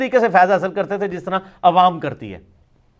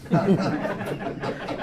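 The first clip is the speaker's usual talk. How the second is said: laughter; 2 semitones lower, 9 dB quieter than usual. Real, not acted. Laughs at his own joke.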